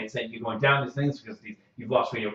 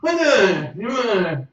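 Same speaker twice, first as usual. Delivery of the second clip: high, loud frantic shouting; scream, terrified and confused; panicked yelling prisoner; 13 dB louder than usual. Acted. NPC panics in straitjacket.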